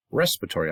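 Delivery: respiratory as RES-pir-a-tory; American-style "respiratory", first syllable stressed. Shown as a demonstration. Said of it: "accent on the first syllable".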